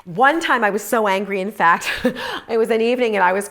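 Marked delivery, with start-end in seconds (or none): none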